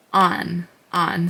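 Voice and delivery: "on" ending in a clear n; final n sound. Shown as a demonstration. Teacher showing the letter as a final n. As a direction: close "on" firmly on the n. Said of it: "you would make the n sound".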